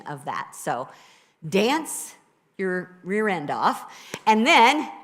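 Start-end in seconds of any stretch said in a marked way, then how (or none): none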